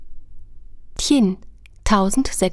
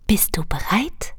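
soft whispered question; 4 dB quieter than usual. Acted. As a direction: whisper it into a child's ear. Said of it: "flüsterte sie ihm ins Ohr". (geflüstert)

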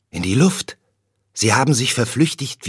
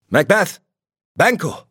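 much higher, glad hails; joyful calling; 9 semitones higher, 3 dB louder than usual. A narrator's reading. Ross greets the two generals, joyful higher call. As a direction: call out to them happily. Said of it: "Rief dieser erfreut".

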